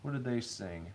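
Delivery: ordinary talk, nothing marked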